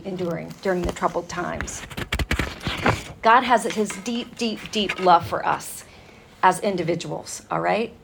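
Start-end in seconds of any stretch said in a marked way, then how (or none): none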